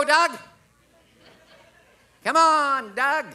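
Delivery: high, drawn-out hollering call; calling the owl. Retold or imitated. Falconer calling his owl across thirty yards, calling out. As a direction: call out loudly to a far-off bird, high and sustained. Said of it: "the Falcon guy starts calling the owl".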